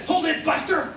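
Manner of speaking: high, sharp mock scolding of his father; a child talking back to father in a bossy tone; sharp tone to dad. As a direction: snap at dad with a cheeky scold. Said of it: "in that tone of voice".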